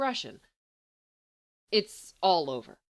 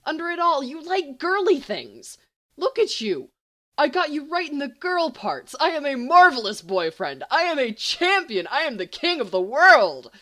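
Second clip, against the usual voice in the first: loud, high crowing boast; gloating triumph; 7 semitones higher, 10 dB louder than usual. Acted. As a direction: crow like you've won everything. James gloating over his success.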